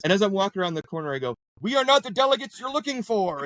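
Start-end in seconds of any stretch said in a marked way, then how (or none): 1.6-3.4 high-pitched, put-on Jedi line; Jedi mind trick. Joking to the guy while rounding the corner, Jedi mind trick. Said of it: "and do the Jedi mind trick".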